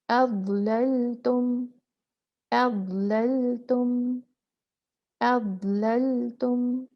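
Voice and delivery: अबललतुम drawn out slowly, letter by letter; Arabic letter demo. Shown as a demonstration. Teacher models अबललतुम slowly for students. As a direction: sound out अबललतुम with the teacher's articulation.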